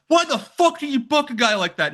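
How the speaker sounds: angry yell. Retold or imitated. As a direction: shout it at the person throwing him out. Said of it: "he's like".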